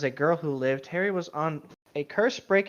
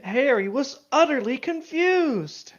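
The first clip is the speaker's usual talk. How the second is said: dramatic read; 8 semitones higher, 5 dB louder, slower than usual. Acted. Dramatic reading of fic line.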